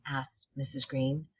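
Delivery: ordinary delivery, nothing marked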